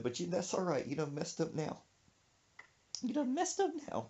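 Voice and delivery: quiet threatening mama. Retold or imitated. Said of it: "She said".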